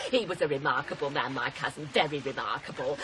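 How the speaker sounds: sly. Acted. Sly remark on dead cousin.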